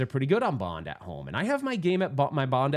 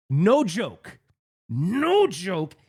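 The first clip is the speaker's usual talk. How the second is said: "No joke" loud and higher-pitched, each word punched; emphatic insistence; 4 semitones higher, 5 dB louder, much slower than usual. Real, not acted. Player swearing his wild tale is true, emphatic insistence. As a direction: stretch and hit "No joke" hard. Insisting on absurd ball speed.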